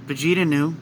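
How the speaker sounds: ordinary delivery, nothing marked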